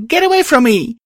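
loud, very high panicked cry; shrieking. A narrator's reading. Star pushing away from the ghost, shrieking. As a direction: shriek to drive them off. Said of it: "I shrieked".

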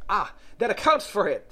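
high, loud Hatter voice; knowing Hatter. Acted. Hatter seizing on Alice's admission, high and knowing.

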